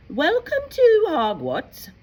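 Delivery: high, loud, grand welcome; McGonagall's welcome. Acted. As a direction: greet the first-years with ceremony. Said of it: "said Professor McGonagall".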